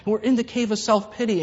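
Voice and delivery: usual speaking voice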